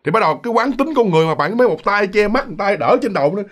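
ordinary delivery

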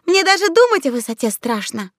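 high, mildly embarrassed confession; embarrassed admission. A narrator's reading. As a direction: sheepishly own up to a fear. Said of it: "смущённо признался овощелюд".

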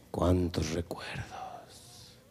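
soft, slow whisper; nostalgic whisper. Acted. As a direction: breathe it out dreamily. Prince's nostalgic sigh.

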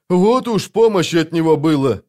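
high, exasperated complaint; narrated irritated grumbling, high-voiced; irritated grumble. A narrator's reading. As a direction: gripe sarcastically with the pitch pushed up. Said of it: "раздражённо проворчал Вал".

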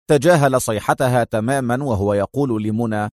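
ordinary delivery

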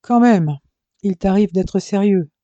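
loud, high astonished quip; mock astonishment; surprised. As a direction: feign amazement. Surprised at brother's sincerity.

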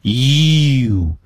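"you" with a long tense ee gliding into oo; strongly held, drawn-out "you"; forceful, precise pronunciation. Shown as a demonstration. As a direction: say "you" long and firm, lips pushed forward. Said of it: "要有，要有力度".

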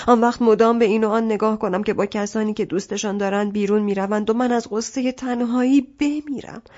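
ordinary delivery

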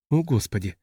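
тихое бормотание себе под нос. A narrator's reading. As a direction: mutter it softly under your breath. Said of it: "пробормотала она". (тихо пробормотать)

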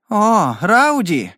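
high, slow, delighted cry of his name; light up with joy as you say it; slow, beaming cry of delight; joyful exclamation. A narrator's reading. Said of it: "радостно сверкая глазами, воскликнула".

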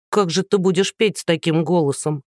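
usual speaking voice

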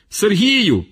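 high calling out with relief; stuck official calls to his driver; joyful call. Acted. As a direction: shout gladly from up the tree. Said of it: "гукнув з груші начміл".

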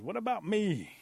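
high, loud wail for attention; left-out protest. Acted. Rabbit passed over by the girl, loud high protest. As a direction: cut in high and loud, wanting praise too.

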